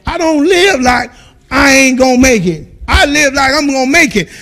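normal talking voice

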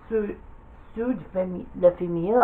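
ordinary talk

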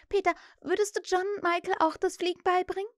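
put-on high girl's request; eager girl. A narrator's reading. Wendy asks Peter to teach her brothers, eager.

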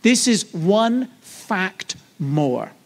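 drawn out, weight on this; slow emphatic. Shown as a demonstration. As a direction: unpack the acronym slowly and deliberately. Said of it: "is O F M".

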